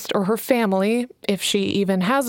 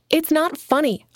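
snapped; 6 semitones higher than usual. Acted. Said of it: "Alana snapped".

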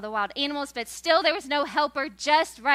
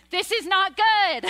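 higher, louder dismayed "This is not good"; God dismayed; 6 semitones higher, 5 dB louder than usual. Retold or imitated. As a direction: act God recoiling at the sight of man. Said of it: "he's like".

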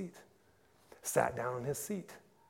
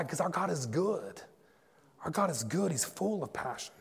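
ordinary talk, nothing marked

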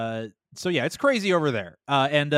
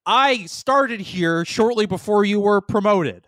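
acting Zach to camera; 5 semitones higher, 6 dB louder, slower than usual. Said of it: "he looks at the camera and he goes".

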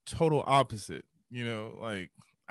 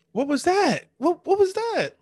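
high, loud, disbelieving question; re-enacted stunned wonder, pitch leaping; astonished; 5 dB louder than usual. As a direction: blurt it out in amazement. Retells astonishment at the experience.

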